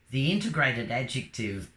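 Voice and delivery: ordinary talk, nothing marked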